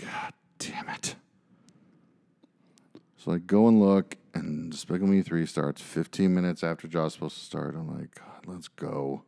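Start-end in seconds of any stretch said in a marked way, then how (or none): 0.0-1.1 slow, defeated whisper under the breath; whispered curse. Retold or imitated. Retells giving in to crying kid. 8.1-9.2 low, slow, very soft surrender; resigned giving in. Retold or imitated. Retells reluctantly giving in.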